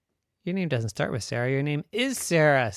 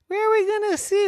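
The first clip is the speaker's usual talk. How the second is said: much higher, puzzled; squeaky little girl; 7 dB louder, faster than usual. Acted. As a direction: ask it in a tiny high voice. Mary objecting.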